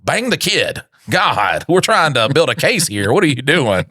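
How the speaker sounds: high-pitched, exasperated mock boss; exasperated supervisor. Supervisor to undercover cop on the phone, exasperated. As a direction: act out an impatient supervisor urging someone on. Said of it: "your supervisor's just like".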